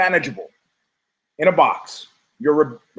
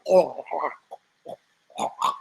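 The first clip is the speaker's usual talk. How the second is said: groggy groans; 6 dB quieter, slower than usual. Retold or imitated. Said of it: "it's more like".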